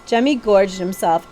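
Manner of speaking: ordinary delivery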